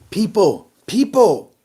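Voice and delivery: high, drawn-out calling; high mock address; mock proclamation. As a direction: mimic a leader addressing people. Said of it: "The next day".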